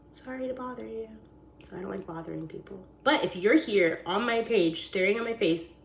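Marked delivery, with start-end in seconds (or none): 0.1-1.1 small, high, soft apology; mock-timid little voice; meek apology. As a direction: retreat sheepishly. Rebuffed caller apologises.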